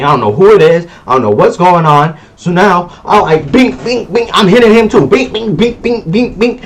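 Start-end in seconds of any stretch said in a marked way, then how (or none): 3.5-4.3 high mock punch noises; punch sound effects. Imitating own punches. 5.1-6.6 higher mock punch sounds, rapid fire; rapid punch noises.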